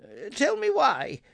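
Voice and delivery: high, stammering fear; trembling. A narrator's reading. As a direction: quaver it out.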